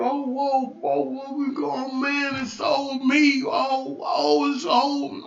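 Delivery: imitating folks moaning in dismay; string it out as a mock wail of woe; mock lament. Mimicking folks upset about forty-five, mock lament.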